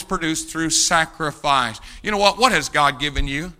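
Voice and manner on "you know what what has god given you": ordinary talk, nothing marked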